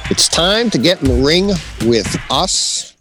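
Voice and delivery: ordinary talk, nothing marked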